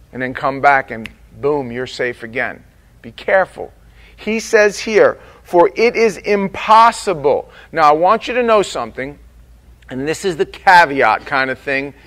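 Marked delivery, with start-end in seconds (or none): none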